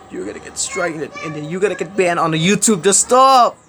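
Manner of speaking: building to a high, loud shout; rising angry rant ending in a yell; angry warning. Real, not acted. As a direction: warn them, then explode on the last words.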